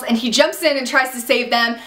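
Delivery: ordinary talk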